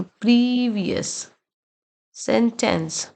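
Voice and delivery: प्रीवियस with a long drawn 'preee', सेंटेंस in two careful beats; slow, syllable-by-syllable प्रीवियस and सेंटेंस; respelled model words. Shown as a demonstration. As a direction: stretch the first vowel of प्रीवियस, then space out सेंटेंस. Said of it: "italicised word को हमने rewrite किया है".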